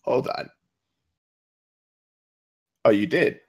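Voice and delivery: ordinary talk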